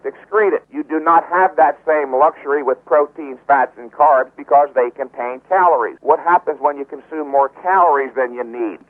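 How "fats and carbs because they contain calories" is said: ordinary talk, nothing marked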